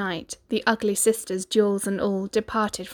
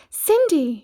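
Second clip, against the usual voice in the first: fairy calling; 8 semitones higher, 6 dB louder than usual. Acted. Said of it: "she cried".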